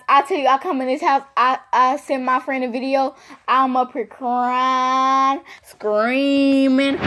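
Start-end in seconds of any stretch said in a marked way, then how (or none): 3.5-7.0 CRYING and SCREAMING held long on a level note; drawn-out emphasis. Real, not acted. Telling viewers about the video sent friend, drawn-out. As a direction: stretch "crying" and "screaming" out dramatically.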